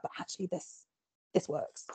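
whispered aside to herself; a whispered realization; hushed thought. Pretend. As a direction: breathe it like a private discovery. Imagined writer's private realization.

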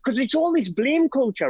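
high, urgent call to stop; fired-up appeal to the room; impassioned plea. Real, not acted. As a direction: push hard to end the blame. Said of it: "rallying plea".

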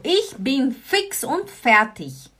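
slow, careful ich bin fix und fertig; slow model phrase. Shown as a demonstration. Teacher slowly modelling the expression. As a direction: give it slowly for learners. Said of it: "Gut, also".

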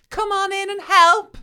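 high, loud, drawn-out mock enthusiasm; overdone welcome. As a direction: call the children in with exaggerated eagerness. Acted welcome to her children.

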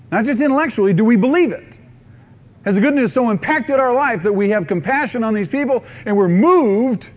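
loud, high, urgent challenge; preaching at full force. Real, not acted. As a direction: press the congregation hard. Preacher pressing the question hard.

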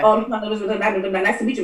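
imitating a rushed, jumbled greeting; excited fast talker. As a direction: rattle it off in one excited tumble. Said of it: "he talks really fast when he's excited".

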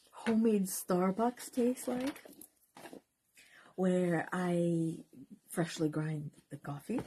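ordinary talk

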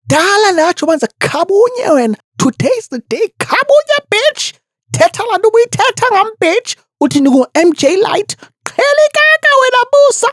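put-on very high, fast furious screech in a thick Xhosa accent; Mihlali. Imagined Mihlali beating someone up in the office, high angry screech. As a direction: imitate a woman screeching mid-brawl. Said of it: "I want the audio".

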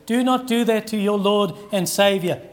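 normal talking voice

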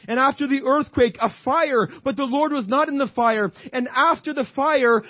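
high, driving sermon delivery; impassioned preaching. Real, not acted. Preacher retelling Elijah's wind, earthquake and fire, impassioned. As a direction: preach it with rising urgency. Preaches the storm passage at full force.

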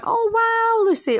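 high, loud, gushing fan voice; mock gushing fan. Mocking fans who gush over her lies, high and gushing. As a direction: ham up an impressed follower. Mocking attention-seeking fans' reactions.

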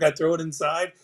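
normal talking voice

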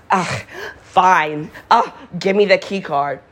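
hammed-up grudging groans, imitating a put-upon friend; obnoxiously exasperated. Friend asked to help drunk neighbor with the door, obnoxiously exasperated. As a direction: overdo the irritated huffing. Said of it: "that obnoxious with it".

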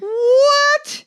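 high, loud, shocked what, near tears; shocked disbelief with a catch of tears; stunned what. Retold or imitated. Birthday boy hearing his dead dad's message, shocked and teary.

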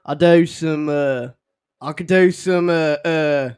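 halting, drawn-out country drawl; mock cowboy accent; Western drawl. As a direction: stretch it out in a Western twang. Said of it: "some of that Western stuff".

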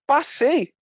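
high, amazed, mock-breathless relief; relieved excitement. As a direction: squeal out the relief, laughing it off. Relief after passing the soldiers.

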